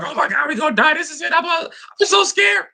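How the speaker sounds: high, squeaky panicked whimper; mock panic. Husband retelling kayak panic, squealing in mock terror. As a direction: act out the terror of a scared husband.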